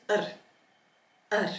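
"r" with no vowel attached; consonant-only R. Shown as a demonstration. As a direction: say "r" as a bare trilled consonant. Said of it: "it is just the consonant sound".

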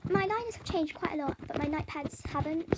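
usual speaking voice

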